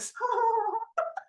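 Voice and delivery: high breathy mock sob; imitated crying noise. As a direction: act out being overcome, high and wordless. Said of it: "and I was going".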